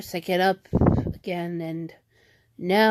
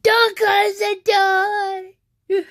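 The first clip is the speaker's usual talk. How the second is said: high, drawn-out mock wail; imitating brother's tantrum; 10 semitones higher, 3 dB louder, much slower than usual. Imitating brother's bedroom protest to sister, high drawn-out tantrum. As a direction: stretch it out in a high, whiny brother impression. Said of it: "recreate last night".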